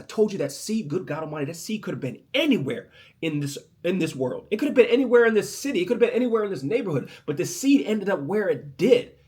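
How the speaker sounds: loud, fast, high rising preach; a preacher climbing to a shout about the seed; full-force preaching. Acted. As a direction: build it up and preach it hard.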